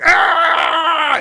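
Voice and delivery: sudden high loud yell; rage bait shout. Shown as a demonstration. Streamer demonstrating a rage-bait video, loud mock yell. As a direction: scream like a rage-bait thumbnail. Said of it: "rage bait, just going".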